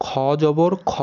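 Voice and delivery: খ as a full-mouthed "khaw"; খ with a back, rounded vowel; heavy rounded vowel. Shown as a demonstration. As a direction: round the final খ, no aa. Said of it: "সঠিক উচ্চারণটা কি দেখেন".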